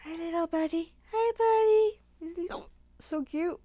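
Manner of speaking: high cooing dog voice. Real, not acted. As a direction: coo at the little dog. Cooing at the puppy.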